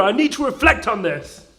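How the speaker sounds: normal talking voice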